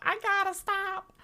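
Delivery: playful high-pitched self-scolding; high-pitched wail. Real, not acted. Repeats line as song-like cry.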